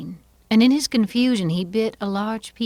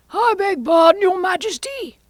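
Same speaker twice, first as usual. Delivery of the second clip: very high, nervous pleading voice; Hatter; 7 dB louder than usual. Acted. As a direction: beg the king's pardon, pitched way up. Nervous Hatter apologises to King.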